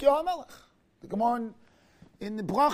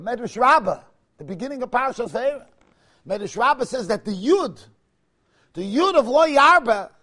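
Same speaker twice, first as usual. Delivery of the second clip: normal talking voice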